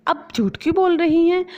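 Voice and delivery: bahu feigning shock. Acted. Bahu exposes mother-in-law.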